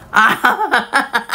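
slow hearty laugh. Real, not acted. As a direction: let out a real laugh. Laughing at livestream comment.

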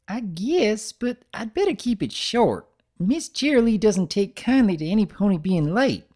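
put-on high, eager little-girl voice; little sister greeting her big brother brightly; young filly voice. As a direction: bounce it up high like an excited kid. Narrator voices Apple Bloom.

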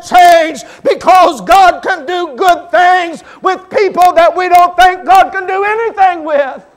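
a Baptist preacher hitting the climax of his story; preaching at full force. Acted. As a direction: build it up and shout it to the rafters.

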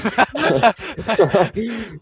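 loud, high laughter; real, loud fit of laughter; laughing. Real, not acted. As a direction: burst out in a long, high laugh. Laughing at friend in game.

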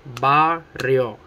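barrio broken into BA, RRIO; split into syllables. Shown as a demonstration. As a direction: say barrio one syllable at a time. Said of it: "two syllables".

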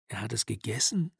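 hushed, unvoiced whisper; disbelieving whisper. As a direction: breathe it out in shock. Disbelieving reply to shocking news. (ungläubig geflüstert)

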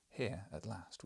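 ordinary delivery

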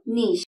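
"നീഷ്" as NEESH, one long syllable; correct pronunciation. Shown as a demonstration. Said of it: "ഒരിക്കൽ കൂടി പറയൂ". ("നീഷ്" ശരിയായി, "ഈ" നീട്ടി)

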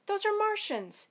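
high, loud alarmed shout. A narrator's reading. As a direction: shout it over the roar of an invasion. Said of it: "she shouted over the roar of the invasion".

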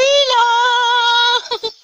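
high burst of laughter; loud nervous laugh. Real, not acted. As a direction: laugh out loud after the scare. After wasp scare.

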